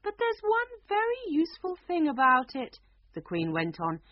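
a queen pleased with her topsy-turvy world. Acted. Queen goes on explaining.

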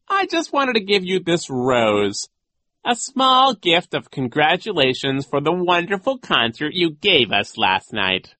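high, loud, slow character voice; flattering Genghis. A narrator's reading. Genghis congratulates Nero on the concert, drawn-out flattery. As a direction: lay on the praise slowly.

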